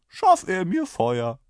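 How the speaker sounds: high, loud, slow order; commanding rider. A narrator's reading. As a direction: demand a light unhurriedly. (gebieterisch, hoch und gedehnt)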